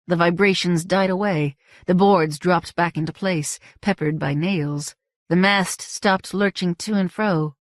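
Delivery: plain reading voice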